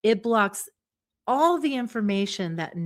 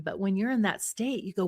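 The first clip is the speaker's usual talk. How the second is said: usual speaking voice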